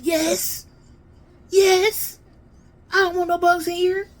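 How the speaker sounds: high, loud drawn-out insistence; emphatic agreement. Retold or imitated. Child explaining the bag left outside, emphatically. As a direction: insist at the top of your voice.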